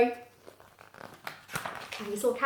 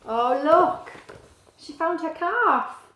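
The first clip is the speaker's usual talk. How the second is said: delighted; 7 semitones higher, 6 dB louder, slower than usual. Real, not acted. Delighted at reunion.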